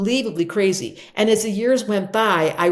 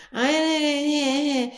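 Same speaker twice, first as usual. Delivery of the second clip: high, drawn-out wordless whine; complaining whine; 7 semitones higher than usual. Acted. Mimicking people who wish life was different, whining noisily. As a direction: make a noisy, wavering complaining noise. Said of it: "all that noisy sounding, complaining".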